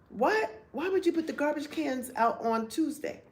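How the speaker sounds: high, sharp disbelief; shocked at the cans on the curb; incredulous what. Retold or imitated. Wife confronting husband about the early garbage, incredulous.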